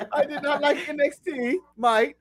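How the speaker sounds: laughing fake-British impersonation; bad British accent, breaking into giggles; mock British accent. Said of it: "you try and impersonate me".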